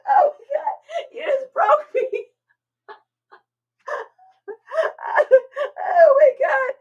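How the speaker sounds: high, loud tearful laughing cries; losing it completely with laughter; crying with laughter. Real, not acted. Broken up laughing at a funny video on stream. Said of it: "I'm actually crying".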